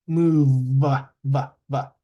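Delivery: "Move" loud, then repeated voiced "va"; last-sound demo. Shown as a demonstration. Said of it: "the last sound is V".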